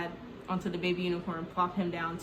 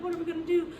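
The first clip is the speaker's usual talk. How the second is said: helpless; 9 semitones higher, much faster than usual. Retold or imitated. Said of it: "the mom is, like, helpless".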